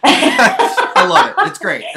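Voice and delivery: laughing through the words; high squeal of laughter. Real, not acted. Laughing at her accent remark.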